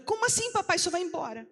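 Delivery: put-on a child's alarmed protest as he leaves; shocked cry. Menina surpresa que o pai vai. (grito alto e agudo de espanto)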